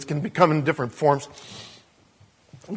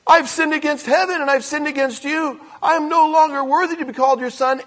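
high, loud protest between hugs; flustered son; 9 semitones higher, 6 dB louder than usual. Acted. Son trying to give his speech to his father, flustered and high. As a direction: squeeze the speech out while being hugged.

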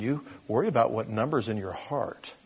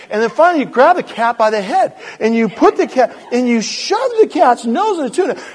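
high, full-voiced comic rant; exasperated yelling; 12 dB louder than usual. Preacher acts out shoving cat's nose in tuna, exasperated yelling. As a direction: build to a high-pitched, exasperated shout. Worked-up climax of the cat story.

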